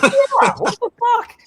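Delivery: a sudden high, loud yelp of outrage; yelping what-the-hell disbelief; outraged disbelief. Real, not acted. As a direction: blurt it out, shocked and fed up. Outraged reaction to dick pics.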